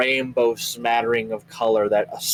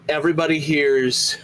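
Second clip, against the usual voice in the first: ordinary delivery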